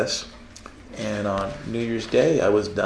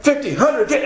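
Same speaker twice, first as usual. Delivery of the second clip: high, loud flustered bargaining; frantic haggling; 3 dB louder than usual. Retold or imitated. Late at night, torn over the book price, flustered haggling. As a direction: torn between two prices. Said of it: "and I'm like".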